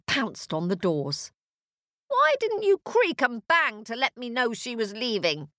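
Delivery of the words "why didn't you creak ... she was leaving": cross, higher-pitched telling-off; scolding witch. Acted. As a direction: scold the cat indignantly.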